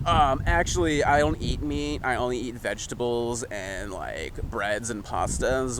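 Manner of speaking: understated mocking put-on voice; smug vegetarian. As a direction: mimic a self-satisfied vegetarian, softly drawn out.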